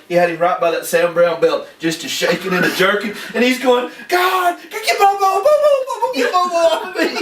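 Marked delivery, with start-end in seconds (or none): 4.1-7.2 high, frantic yelping plea; panicked plea. Retold or imitated. Deputy bitten by the Airedale, high panicked yelling. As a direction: squeal for help as the dog bites.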